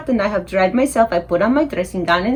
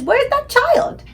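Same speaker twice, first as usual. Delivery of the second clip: high, slow, drawn-out question; mother calling out for a missing child; puzzled mother; much slower than usual. Acted. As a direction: sing out the question high and long. Mother looking for invisible child.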